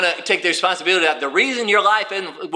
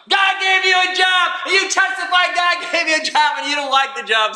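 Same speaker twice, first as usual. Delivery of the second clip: preaching shout; 10 semitones higher than usual. Real, not acted. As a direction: hammer it home to the crowd.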